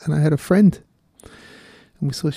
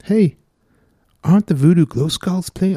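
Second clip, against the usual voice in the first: put-on a half-hearted Irish accent, higher and louder; failed Irish accent; 5 semitones higher, 4 dB louder than usual. Radio host failing an Irish accent for co-host. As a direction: attempt an Irish accent and lose it. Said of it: "I can't say Voodoo Glow Skulls in an Irish accent".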